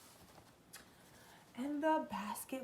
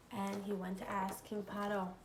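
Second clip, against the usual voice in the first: usual speaking voice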